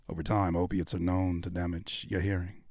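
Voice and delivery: low, soft ominous delivery; mock ominous warning, low. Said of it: "that ominous spirit".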